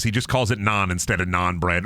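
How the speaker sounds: normal talking voice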